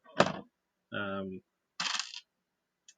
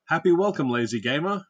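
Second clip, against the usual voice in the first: cheery shout-out; 5 semitones higher, 7 dB louder than usual. Shown as a demonstration. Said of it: "How was that?".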